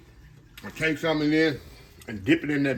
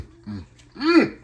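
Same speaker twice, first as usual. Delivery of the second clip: high pleased hum of tasting; 8 semitones higher, 5 dB louder than usual. Real, not acted. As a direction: hum with delight over the bite. Tasting the first fritter.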